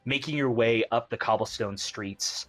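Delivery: game master painting the street, unhurried; scene setting. Acted. Game master describes the bright city to the players, narration voice. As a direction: draw the players into the sunny city.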